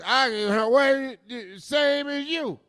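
high, slurring tipsy voice; drunk lush voice. Retold or imitated. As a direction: slur it like a happy lush. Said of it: "Lucy the lush".

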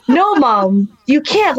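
retold objection through a laugh; mildly exasperated mock scolding; laughing protest. Fighter refuses his mom's dirty advice, laughing protest.

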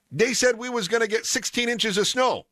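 high, comically indignant put-on accent; Pops's accent. Pops complains about the snow forecast, mock-indignant accent. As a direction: read his text in a folksy accent. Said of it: "I always read his texts in an accent".

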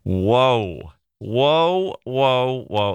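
mock-shocked halting whoas; playful protest; taken aback. Real, not acted. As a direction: pull up short in amused surprise. Stops at the squirrel remark.

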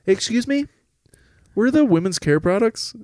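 squeaky polite customer asking; high shopper voice. Retold or imitated. Shoppers quoted, high pitch.

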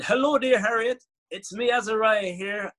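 put-on high, lilting Caribbean accent; hammed-up Caribbean phone greeting; Caribbean Man. Calling the journalist back in the persona, Caribbean accent. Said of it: "talk like that".

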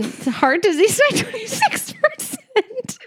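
high voice breaking into real laughter; helpless giggles through the words; laughing while reading. Real, not acted. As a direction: struggle to read the stats without cracking up. Cracking up at grim stats.

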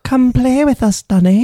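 put-on high, eerie British child voice; high sing-song ghostly British invitation; creepy British twins. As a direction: chant it like a creepy English twin. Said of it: "the accent is creepier".

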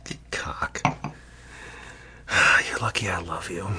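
exasperated whisper; loving exasperation. Real, not acted. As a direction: whisper a fond insult. Teasing whisper to the birthday friend.

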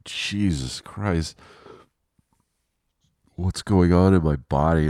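mock morning-after-waking disbelief; acted dismay. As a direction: stretch the oath out, then exclaim. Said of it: "you're just like".

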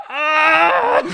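high loud startled yelp; mock scream. Moses seeing leprous hand, mock shriek. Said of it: "looking at his hand going".

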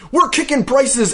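very high hammed-up commercial shout; raging ad pitchman. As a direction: imitate a karate-chopping salesman in a mock rage. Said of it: "chopping the prices down in a fit of rage".

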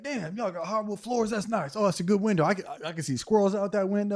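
high-pitched dog checking out the place; high, approving doggy voice; impressed dog. Acted. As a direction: admire the house as a naive pup. Voices dog inspecting new home.

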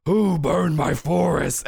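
slow growl of a giant bear; growling monster. Acted. Giant bear demanding who burned its forest, growling monster. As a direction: growl it out slowly. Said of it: "you hear this voice from behind you just, uh, growl".

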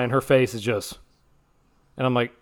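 normal talking voice

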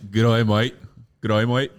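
loud Australian greeting; Aussie g'day. Shown as a demonstration. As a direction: give it your best Aussie g'day. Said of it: "I wanna get your best g'day, mate".

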